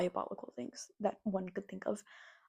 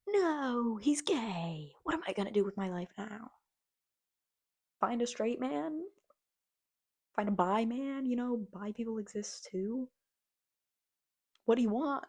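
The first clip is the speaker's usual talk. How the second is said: higher, hammed-up wail; mock despair; 3 semitones higher than usual. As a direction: overplay a dramatic fan. Mocking disappointed women fans.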